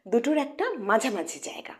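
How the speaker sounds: normal talking voice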